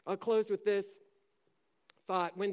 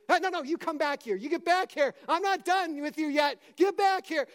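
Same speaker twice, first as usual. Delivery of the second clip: rising, louder calls to come back; commanding the devil; 5 semitones higher, 3 dB louder than usual. Retold or imitated. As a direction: order the devil back, building up. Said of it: "You just say".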